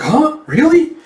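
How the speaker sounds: high, rising mock disbelief; loud, squeaky double take; incredulous. Reacting to the soldier's news of the bandits, incredulous.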